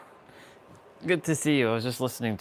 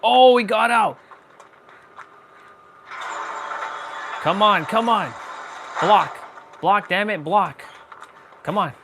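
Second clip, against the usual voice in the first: shouting orders over battle; 8 semitones higher, 5 dB louder than usual. Real, not acted. Yelling at teammates mid-chase.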